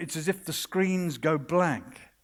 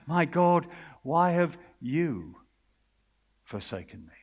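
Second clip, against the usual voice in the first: anguished cry; 2 semitones lower than usual. Acted. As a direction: let the plea die away. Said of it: "It's Jesus crying".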